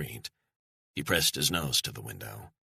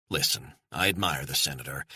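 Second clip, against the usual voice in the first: ordinary delivery, nothing marked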